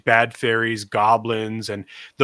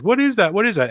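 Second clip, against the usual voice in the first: a lightly voiced curious child, pitched higher; a hint of a wide-eyed kid; kids asking; 9 semitones higher, 4 dB louder, faster than usual. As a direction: mimic small kids pointing at the door. Kids asking about small door.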